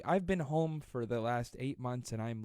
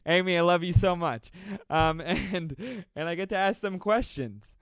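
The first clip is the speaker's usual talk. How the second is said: laughing apology; 5 semitones higher, 9 dB louder than usual. Real, not acted. Backpedalling after teasing joke.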